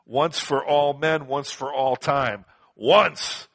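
slow, stressed ONCE for all men, once for all time; emphatic once. Shown as a demonstration. Preacher to congregation, emphatic slow ONCE. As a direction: drive home ONCE, slowly and firmly. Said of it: "The, the great emphasis here".

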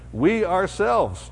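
"We ourselves" slow and high, leaning on "We"; slow emphatic stress on "We" in "We ourselves". Shown as a demonstration. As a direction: stretch out "We ourselves" with weight on "We". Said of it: "just to make it emphatic".

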